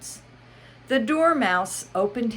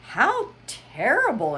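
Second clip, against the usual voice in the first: shocked exclaim; 8 semitones higher, 4 dB louder than usual. Acted. Alice exclaims.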